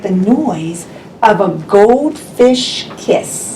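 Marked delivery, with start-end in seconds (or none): 3.0-3.5 fish kiss smack. Pretend. Goldfish kiss sound.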